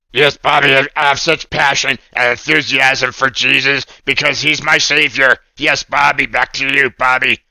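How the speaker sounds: lightly voiced eager puppet; a hint of a cartoon puppet full of zeal; bouncy puppet enthusiasm; Oscar puppet voice.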